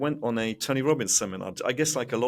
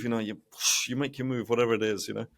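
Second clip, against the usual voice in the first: ordinary delivery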